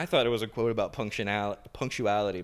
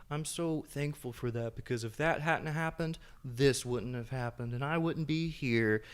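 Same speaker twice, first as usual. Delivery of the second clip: mocking voice; 5 semitones higher than usual. Podcaster imitating what people always say about the past, mocking. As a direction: parody someone gushing with thanks. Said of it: "I say that in a mocking voice".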